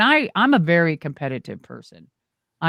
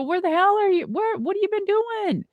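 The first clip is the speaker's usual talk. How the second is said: high, astonished retold question; playfully acting her own shock; surprised; 13 semitones higher than usual. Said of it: "I go".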